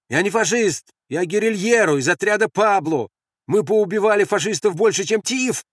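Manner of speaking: high, loud declaration; shouted self-defence. Acted. Guerrilla proving he is no fascist, shouting. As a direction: proclaim it up to the doubters. Shouting his identity to sentries.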